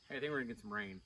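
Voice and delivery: ordinary delivery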